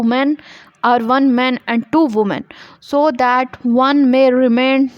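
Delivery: reading law text slowly and deliberately; measured dictation pace for students; slow statute reading. Acted. Reads statute text aloud. (धीमे-धीमे क़ानून की इबारत पढ़ना)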